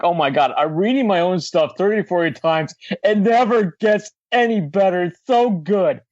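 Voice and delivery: mock self-satisfied gushing; smug bragging. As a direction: gush over your own writing, smugly. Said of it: "like, ass move to say".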